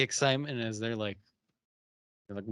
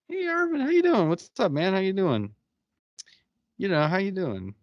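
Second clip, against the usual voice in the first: high friendly greeting; 7 semitones higher, 3 dB louder than usual. Retold or imitated. Charles greeting neighbor Arvin.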